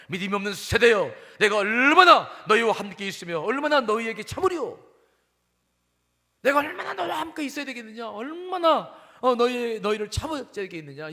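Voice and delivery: loud, rising exasperated scolding; 예수, angry rebuke. Acted. Jesus rebuking disciples who failed to heal a boy, angry outburst. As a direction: cry out in acted anger, easing at the end. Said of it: "화를 내십니다".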